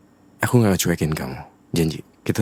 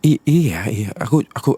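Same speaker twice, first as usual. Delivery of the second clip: flustered; 6 semitones higher, 6 dB louder than usual. Acted. Caught out, stammering reply.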